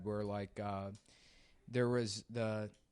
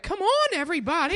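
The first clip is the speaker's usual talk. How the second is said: much higher, loud squeaky coaxing; high-pitched caricature; 11 dB louder than usual. Retold or imitated. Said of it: "He's like".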